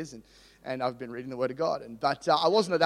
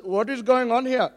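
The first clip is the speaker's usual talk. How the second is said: put-on high, loud Indian-accented question; 7 semitones higher, 5 dB louder than usual. Lease manager walking in to order pizza, imitated Indian accent. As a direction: imitate an Indian man demanding an explanation. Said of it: "so I can do his accent".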